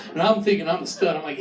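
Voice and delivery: ordinary delivery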